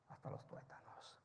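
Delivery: sudden dramatic whisper. Acted. Preacher hushing the church for emphasis, whispered. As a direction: drop to a hush for effect.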